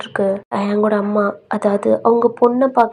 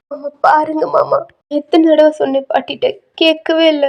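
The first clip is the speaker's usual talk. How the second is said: higher-pitched, mildly tearful appeal; upset complaint; 5 semitones higher, 3 dB louder than usual. Acted. Crying grandson complaining to uncle about grandmother, upset complaint.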